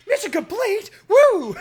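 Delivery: high triumphant whoop; excited cheer. Real, not acted. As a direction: celebrate the win loudly. Mission cleared.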